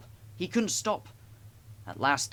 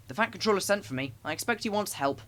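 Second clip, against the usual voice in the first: important; 7 semitones higher than usual. A narrator's reading. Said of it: "he said importantly".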